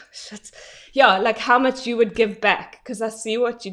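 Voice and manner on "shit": breathless curse. Real, not acted. Tearing up mid-sentence.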